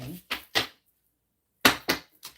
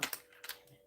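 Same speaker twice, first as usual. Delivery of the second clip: whispered; 3 semitones lower, slower than usual. Real, not acted. Muttered aside at bad card.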